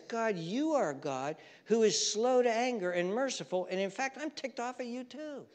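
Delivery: high-pitched imitation of an angry complaint; mock anger. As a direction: complain to God, playing ticked off. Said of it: "He's angry".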